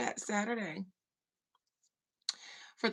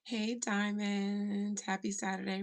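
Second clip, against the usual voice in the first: ordinary delivery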